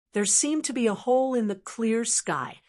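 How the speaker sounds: usual speaking voice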